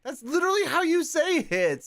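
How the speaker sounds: high-pitched, insistent comeback; indignant protest. Real, not acted. Streamer argues with Canadians about pronunciation, high protest.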